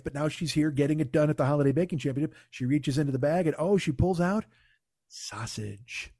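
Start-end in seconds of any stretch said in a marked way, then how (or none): 5.1-6.1 low, quiet deadpan drop; hushed reveal. Retold or imitated. Revealing the unlucky ingredient she pulled out, hushed reveal. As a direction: let the word fall softly and low. Dramatic reveal of bag contents.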